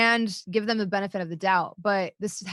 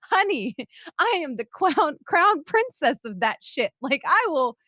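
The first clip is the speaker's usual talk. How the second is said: higher, slow, drawn-out sass; sassy diva comeback; 6 semitones higher, 4 dB louder than usual. Retold or imitated. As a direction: play the crowned queen of sarcasm, slow and high.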